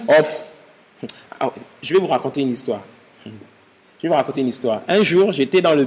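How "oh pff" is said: dismissive scoff. Retold or imitated. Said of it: "ils disent".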